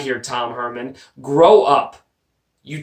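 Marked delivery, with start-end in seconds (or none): 1.2-2.0 loud, blunt order; loud scolding of a player; loud snap. Real, not acted. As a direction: bark it at him like a scolding. Ranting at Tom Herman.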